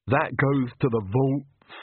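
slow Igor. Acted. Igor answering.

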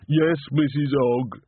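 put-on slow, high, childlike voice; slow simple man. A narrator's reading. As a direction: answer slowly like a simple soul.

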